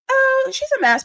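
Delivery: mock high gasp. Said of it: "you're like".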